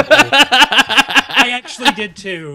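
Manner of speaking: loud, slow laughing admission. Real, not acted. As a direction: laugh the words out. Laughing confession.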